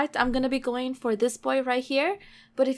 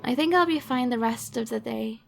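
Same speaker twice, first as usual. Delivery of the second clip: ordinary talk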